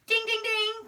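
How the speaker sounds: high sing-song ding, ding, ding; teacher cheering a found compound word; playful bell. Shown as a demonstration. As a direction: ring it out like a game-show bell. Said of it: "bell sound for compound".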